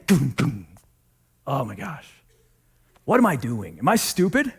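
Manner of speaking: low, hushed, defeated muttering to herself; despairing inner voice. Retold or imitated. Rejected author muttering to herself, low and defeated.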